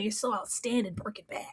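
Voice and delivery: breathy swooning whisper. Pretend. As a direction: sigh the name like a lovesick fan. Mock swooning aside.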